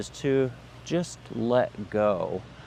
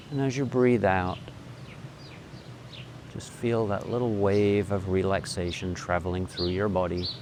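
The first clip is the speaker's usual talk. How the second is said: guided meditation; 3 semitones lower, 4 dB quieter than usual. Acted.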